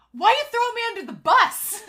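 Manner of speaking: high, loud mock outrage; indignant protest. Grandson protesting being thrown under the bus, indignant. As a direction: play up the wounded complaint, loudly.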